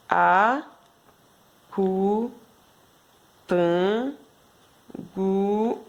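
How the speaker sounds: a-cu-tan-gu-lo, each syllable drawn out with long gaps; split into syllables. Shown as a demonstration. Teacher shows the class how to split the word into syllables. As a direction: slowly break "Acutangulo" into its five syllables. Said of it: "você faz assim, ó".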